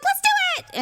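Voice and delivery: put-on very high, eager girlish squeal; retold excitement. Daughter volunteering for a flu shot, imitated excitement. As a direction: imitate a kid bursting with eagerness. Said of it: "she was, like, all excited".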